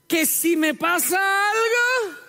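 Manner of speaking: wife answering her husband, slow and sarcastic. Retold or imitated. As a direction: throw the question back with heavy sarcasm. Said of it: "responde con una pregunta sarcástica".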